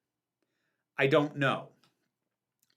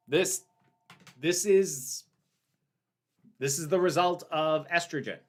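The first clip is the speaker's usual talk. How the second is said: ordinary delivery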